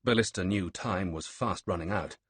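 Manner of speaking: ordinary delivery, nothing marked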